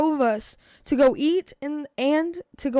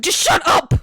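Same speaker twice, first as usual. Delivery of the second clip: loud, higher acted outburst; exasperated shout; 4 semitones higher, 8 dB louder than usual. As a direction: shout it as a retold comeback. Acts out his exasperated retort.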